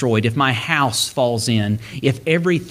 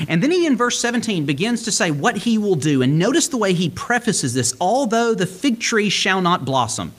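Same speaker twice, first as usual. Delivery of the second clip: raised, fervent preaching voice; 7 semitones higher than usual. Acted. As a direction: lift the pitch high and press the message. Passionate preaching run.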